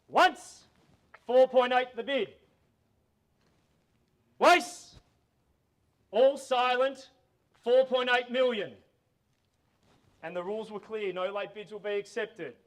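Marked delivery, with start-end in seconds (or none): 0.1-8.7 auction call. Real, not acted. Calling final bids.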